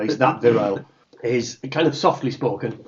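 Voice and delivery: ordinary talk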